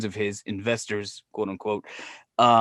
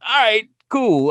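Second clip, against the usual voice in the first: mock casual agreement; 9 semitones higher, 6 dB louder, slower than usual. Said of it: "I was like".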